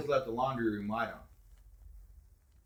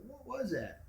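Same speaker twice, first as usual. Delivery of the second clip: "What was that" softer, to yourself; to myself; 13 semitones higher, 10 dB quieter, slower than usual. Retold or imitated. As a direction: murmur "What was that" in your head. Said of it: "I was thinking to myself".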